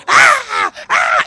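high, loud mock yell of "ah"; a hammed-up furious crowd cry; mock angry yell. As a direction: imitate the crowd yelling at the Christians. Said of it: "la gente estaba gritando".

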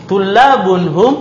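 Tullabun hum rising as a question; question tune. Shown as a demonstration. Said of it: "penekanan di hum".

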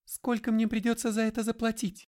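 high-pitched woman's character voice; Katerina's voice. A narrator's reading. Katerina asking McGray about the price, high-voiced. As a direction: lift it well above the narration.

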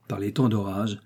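plain reading voice

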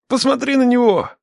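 high, urgent plea; desperate appeal. A narrator's reading. Captive urges the father to look at his son, desperate. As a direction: beg in a voice pitched high.

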